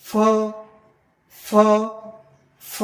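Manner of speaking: ফ with air pushed out, teeth on lip; airy F sound. Shown as a demonstration. Teacher drilling the airflow, ফ as blown F. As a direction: hold the friction in ফ. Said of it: "গলার থেকে আমরা বাতাস বের".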